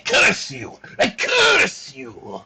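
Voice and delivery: hammed-up witchy curse, drawn out; laying a curse. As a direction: cast a mock curse dramatically. Mock tarot curse on friend.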